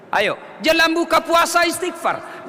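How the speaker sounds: ordinary talk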